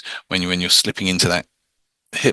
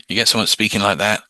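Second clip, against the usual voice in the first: ordinary talk, nothing marked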